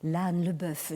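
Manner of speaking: plain reading voice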